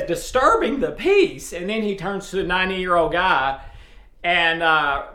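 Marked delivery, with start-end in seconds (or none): 0.0-1.5 disturbing the peace, raised high in disbelief; incredulous question. Retold or imitated. Andy echoing Barney's charge in disbelief, higher. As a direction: echo it back in disbelief. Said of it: "And Andy says".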